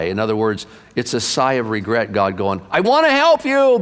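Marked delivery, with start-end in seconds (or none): 2.7-3.8 reach out to them one last time; pleading God. Acted. God pleading before giving up.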